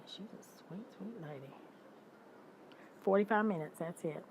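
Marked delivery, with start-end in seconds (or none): 0.1-1.5 whispered, soft and fond; hushed affectionate whisper; tender whisper. Real, not acted. Cooing over her cat Kitty. 3.0-3.8 louder and higher, punching the number; forty-five minutes said loud and high; emphatic. Real, not acted. Repeats the figure twice.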